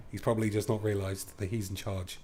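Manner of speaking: normal talking voice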